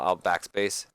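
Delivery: plain reading voice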